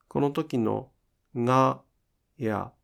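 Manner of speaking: ordinary talk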